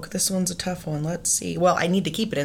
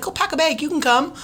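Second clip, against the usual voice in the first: higher, lightly voiced mom giving permission; a hint of a kindly mom; 8 semitones higher than usual. Guest imitates the mom inviting her along. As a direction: say it like an easygoing mom. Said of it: "The mom's like".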